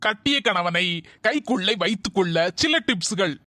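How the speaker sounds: soft, measured reading of a tips list; reading tips aloud. Acted. Husband reads marriage tips to his wife, in a formal reading voice. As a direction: read the tips like a formal article.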